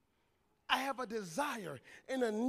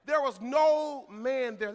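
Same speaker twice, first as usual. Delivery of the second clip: "There was no man there" loud and drawn out; slow, forceful weight on "no man"; emphatic verse quote; 7 dB louder, much slower than usual. Real, not acted. Preacher stressing why God put this there, loud, slow "no man".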